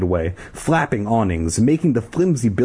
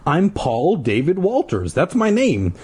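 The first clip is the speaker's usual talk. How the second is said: insistent; 7 semitones higher than usual. A narrator's reading. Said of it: "Paul insisted".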